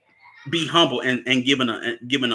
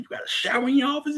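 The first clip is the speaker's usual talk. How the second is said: high, fast incredulous question; surprised high quote; much faster than usual. Retold or imitated. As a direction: squeak it out in disbelief. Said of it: "she's like".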